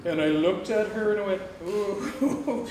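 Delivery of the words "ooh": laughing ooh. Retold or imitated. Recognizing his sister, laughing.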